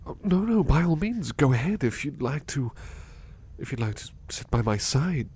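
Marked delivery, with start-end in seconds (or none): 0.0-2.7 higher and louder, eager to please; eager welcome. Acted. Butler eagerly inviting the listener to sit, raised and bright. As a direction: rush to reassure them.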